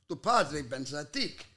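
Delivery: low, soft mock Italian gibberish, in a Barese accent; hammed-up Italian with a low, hushed voice; fake Italian dialect. Comedian faking Italian lines in an Italian accent, low and soft. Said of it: "I go like this".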